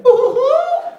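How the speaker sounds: high thrilled squeal, wife voiced; a giddy high-pitched woohoo. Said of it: "She went".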